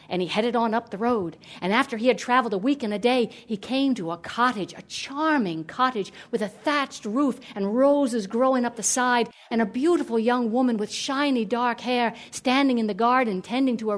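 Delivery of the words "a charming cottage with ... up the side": plain reading voice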